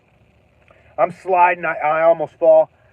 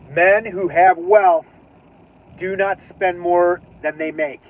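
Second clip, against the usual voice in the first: slow, deliberate recital; reciting a maxim; slower than usual. Retold or imitated. Reminding himself not to overspend, slow deliberate recital. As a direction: spell out the lesson word by word. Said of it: "Remember what you read".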